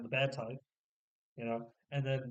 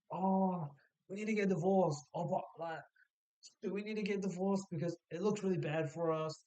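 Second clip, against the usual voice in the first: higher-pitched mock dithering; mock fretting; 5 semitones higher than usual. Couple agonising over getting divorced, mock fretting.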